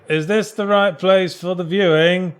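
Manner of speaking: lightly voiced lost visitor asking; imagined house viewer. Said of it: "He's going".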